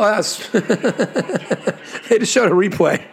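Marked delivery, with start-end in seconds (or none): 0.3-1.7 laughing. Real, not acted. Laughs at own missed replay.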